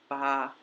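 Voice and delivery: "ba" en tono alto y sostenido; first tone ba. Shown as a demonstration. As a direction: hold "ba" high and level. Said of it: "is the high tone".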